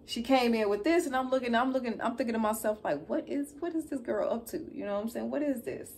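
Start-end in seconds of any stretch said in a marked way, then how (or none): 3.1-4.6 surprised. Retold or imitated. Thinking to herself, shocked. 5.3-5.9 surprised. Retold or imitated. Thinking to herself, shocked.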